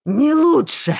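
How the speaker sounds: loud, high yell. A narrator's reading. Marston yelling at the heroine, furious yell. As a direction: bark it back in fury. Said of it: "заорал Марстон".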